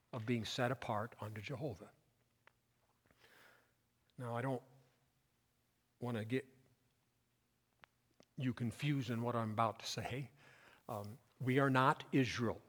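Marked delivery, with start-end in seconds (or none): none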